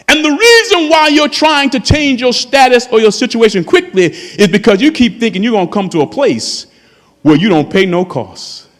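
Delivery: ordinary talk, nothing marked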